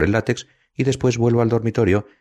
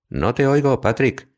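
calling out; 12 semitones higher, slower than usual. A narrator's reading. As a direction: voice pitched well up, calling from another room. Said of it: "grita ella". (grito lejano, agudo, de una habitación a otra)

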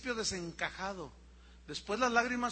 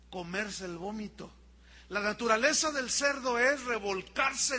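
louder, driving sermon delivery; full preaching; 3 semitones higher, 5 dB louder than usual. Acted. Pastor driving home his point to the congregation, forcefully. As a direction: preach it with rising conviction.

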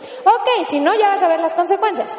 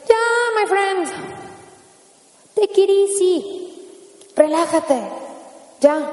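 higher, very slow teasing chill-out; mock calming; 4 semitones higher, much slower than usual. To a complaining victim, higher and slow, mock soothing. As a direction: talk someone down in mock cool. Mocking telling someone to relax.

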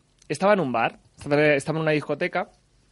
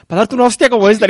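mock threat; 5 semitones higher, 9 dB louder than usual. Veneno threatens the friend.

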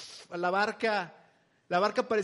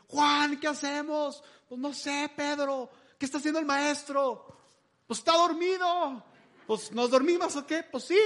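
fretful disciples; 7 semitones higher, 4 dB louder than usual. Retold or imitated. Disciples bickering in the storm.

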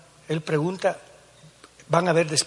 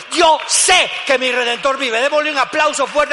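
shouted; 10 semitones higher, 12 dB louder than usual. Shown as a demonstration. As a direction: shout it out to the whole church. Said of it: "Grítelo".

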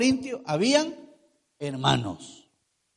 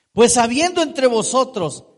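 preaching force; 4 semitones higher, 9 dB louder than usual. Acted.

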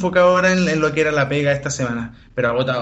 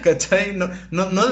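laughing; 4 semitones higher, slower than usual. Real, not acted. Laughs at own joke.

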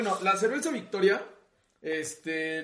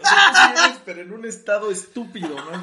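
laughing; 2 semitones higher, 12 dB louder than usual. Real, not acted. Teasing joke about Toluca.

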